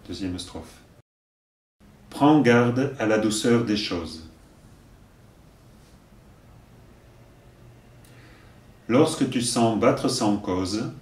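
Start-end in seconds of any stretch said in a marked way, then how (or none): 2.1-10.9 slow, deliberate verse delivery; poem recitation. Acted. Teacher giving verses for learners to repeat, measured recitation. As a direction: recite line by line, leaving room to repeat.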